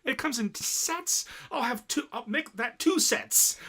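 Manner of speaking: high, slow, gleeful ordering; eager. Retold or imitated. Friend recapping anime, gleefully ordering sets in high voice. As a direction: savor every word like a treat.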